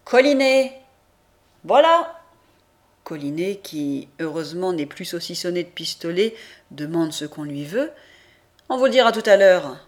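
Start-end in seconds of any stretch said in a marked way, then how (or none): 0.1-0.8 name called out loud and high; roll call. A narrator's reading. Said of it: "appelle des noms". 1.6-2.2 high, loud shout of presence; loud reply from across the room; roll-call answer. A narrator's reading. 8.7-9.8 loud, quick brush-off; impatient official voice, raised; curt official reply. A narrator's reading. Official answers Collinet curtly.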